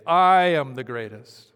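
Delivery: loud, slow, booming I am the greatest; Muhammad Ali boast. Retold or imitated. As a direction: proclaim it like a champion boxer. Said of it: "like Muhammad Ali".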